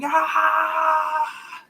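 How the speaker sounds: high, slow, crying shout; screaming. Retold or imitated. Streamer wailing to chat for a girlfriend, slow crying shout. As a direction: wail it out like a desperate cry. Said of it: "I'm screaming".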